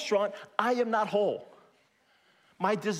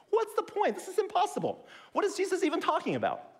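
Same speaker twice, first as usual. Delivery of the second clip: high incredulous whine, much higher; mock the doubter in a high voice; exasperated mock skeptic; 13 semitones higher than usual. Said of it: "A lot of people look at that and just go".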